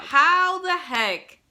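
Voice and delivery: loud, high, stretched-out outburst; exasperated disbelief. Retold or imitated. Viewer scolding Claudia over her father, loud exasperated disbelief. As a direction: drag it out in hammed-up outrage.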